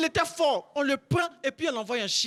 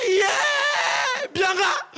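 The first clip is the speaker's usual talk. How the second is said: much higher, loud yelling; fighter's battle cry; 9 semitones higher, 5 dB louder, much slower than usual. Retold or imitated. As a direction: scream like a fighter mid-punch. L'oncle crie sur le ring. (cri de combat, aigu et fort)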